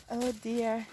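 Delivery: ordinary delivery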